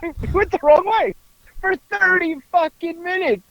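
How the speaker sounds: high-pitched mock exasperation; squawky put-on dismay; mock outburst. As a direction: imitate the driver wailing over his blunder. Imitating driver realizing his mistake.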